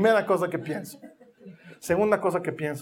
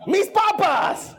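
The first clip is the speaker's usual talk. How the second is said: indignant yell; 8 semitones higher, much slower than usual. Retold or imitated. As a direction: yell like a kid whose fries got stolen. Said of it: "ella grita".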